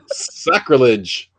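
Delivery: slow, grave mock horror; drawn out like a curse; slow solemn outrage. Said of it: "Helen says".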